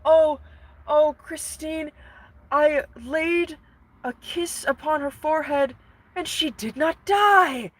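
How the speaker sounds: high, melodramatic lovesick Phantom; hammed-up dying-of-love swoon. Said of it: "That's what he says".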